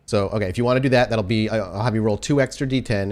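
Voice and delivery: ordinary talk, nothing marked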